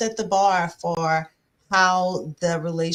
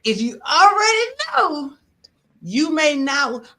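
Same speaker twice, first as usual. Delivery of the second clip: much higher and louder, preacher-style; preaching fervor; 8 semitones higher, 9 dB louder than usual. Acted. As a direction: belt it out like a fired-up preacher. Preaching at women, crowd reacts.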